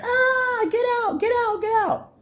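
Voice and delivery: high, crying scream of get out; mock frantic high scream; imitated frantic screaming. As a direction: shriek it like a panicked woman in the hallway. Neighbor girl screaming in hallway.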